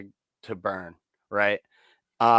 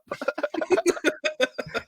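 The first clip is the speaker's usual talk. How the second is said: high giggle. Real, not acted. Laughing at own story.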